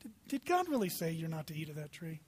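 soft, low, insinuating question; slithering Satan. Acted. Satan in the garden questioning God's word, slithering and hushed. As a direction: slide the doubt in quietly and low. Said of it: "Couldn't you see Satan slithering up".

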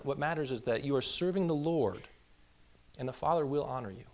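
ordinary delivery

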